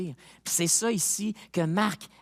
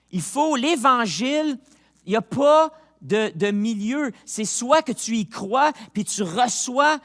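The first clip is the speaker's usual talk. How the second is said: slow, loud and raised, hammering each phrase; preaching at full force; 5 semitones higher, 7 dB louder than usual. Acted. Preacher urging his congregation to choose, full force. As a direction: preach it like a call to decide.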